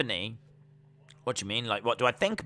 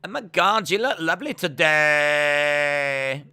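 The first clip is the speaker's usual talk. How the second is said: held sentence endings; 3 semitones higher, 6 dB louder than usual. Shown as a demonstration. Streamer demonstrating his female voice, endings held absurdly long. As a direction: stretch the last word as long as possible. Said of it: "hold on to the end of the sentence".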